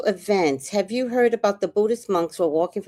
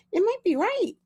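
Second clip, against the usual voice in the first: usual speaking voice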